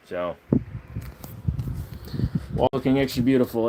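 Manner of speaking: usual speaking voice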